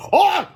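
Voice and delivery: short high, loud scream; cried-out scream. A narrator's reading. Superhero caught by the monster, screaming in alarm. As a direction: yelp out in alarm. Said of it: "cried Counter Defense".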